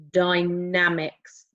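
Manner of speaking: dynamics drawn out slowly, low. Shown as a demonstration. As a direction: stretch out dynamics. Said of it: "Can you say dynamics?".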